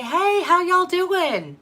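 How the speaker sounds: high, loud, drawn-out mock hello; exaggerated friendly greeting. Greeting a stranger at the grocery store, exaggerated friendliness. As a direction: call it out slowly in a hammed-up singsong.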